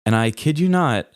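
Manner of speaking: usual speaking voice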